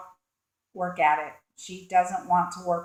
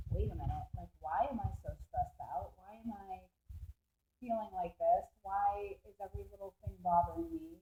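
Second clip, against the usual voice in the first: softer, confused self-questioning; hushed worried inner voice; 15 dB quieter than usual. Retold or imitated. Tarot reader voicing the stressed woman, hushed and worried. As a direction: drop to a hushed, worried murmur.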